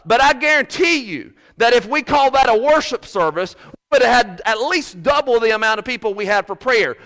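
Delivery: loud, high, driving sermon delivery; fiery pulpit preaching; preaching at full force. Acted. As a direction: preach it hard to the congregation.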